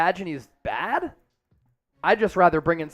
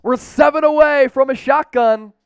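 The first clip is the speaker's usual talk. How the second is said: loud, high donation shout-out; excited announcement; 6 semitones higher, 7 dB louder than usual. Real, not acted. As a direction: hype up the crowd as the goal nears. Celebrating donation near the goal.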